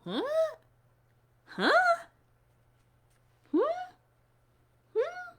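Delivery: put-on rising puzzled huh for the kids; a hint of a puzzled hmm, rising high; mock confused huh. Shown as a demonstration. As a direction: act out being confused. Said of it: "let me see your confused face".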